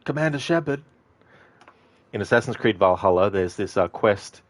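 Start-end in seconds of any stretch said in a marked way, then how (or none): 0.0-0.8 high-pitched mock call, like hailing someone; imitated yelling. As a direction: imitate a woman repeatedly calling out for attention. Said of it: "she would always yell at you".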